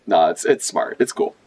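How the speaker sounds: ordinary talk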